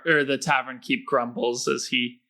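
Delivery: ordinary delivery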